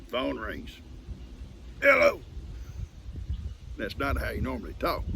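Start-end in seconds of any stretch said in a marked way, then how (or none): 1.8-2.2 high, loud, pitiful "Hello"; calling Cuz as the sick friend answering; imitating sick Doc. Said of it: "I'm gonna try to sound exactly like he sounded".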